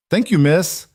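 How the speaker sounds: put-on high, loud grateful child; a small girl thanking a kind stranger; little girl voice. A narrator's reading.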